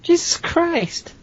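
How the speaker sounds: high, slow exclamation; appalled. Real, not acted. Listener reacts to the fiasco, high drawn-out shock.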